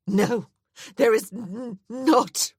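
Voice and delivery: high, loud, breaking protest; sobbing refusal; tearful denial. A narrator's reading. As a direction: choke on the denial while crying. Grieving woman refuses comfort.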